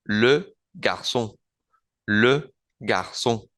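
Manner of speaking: le garçon slowly, le with rounded E, ç as S; slow French demo. Shown as a demonstration. Teacher slowly modelling le garçon for the class. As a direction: stretch out le garçon syllable by syllable. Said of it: "Con la C cedilla, que suena como una S".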